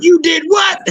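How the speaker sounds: put-on a father catching his kid out; imitating an outraged dad. Dad learning his son littered, outraged disbelief.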